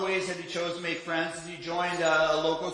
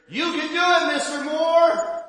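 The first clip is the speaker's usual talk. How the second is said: high, loud cheer from the stands; cheering call from the crowd; 8 semitones higher, 7 dB louder than usual. Retold or imitated. Boy cheers his dad on from the crowd, loud and high. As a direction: call out encouragement across the park. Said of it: "one voice could be heard over the crowd".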